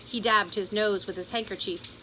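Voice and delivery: ordinary delivery, nothing marked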